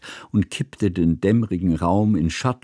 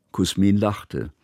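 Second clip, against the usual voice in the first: plain reading voice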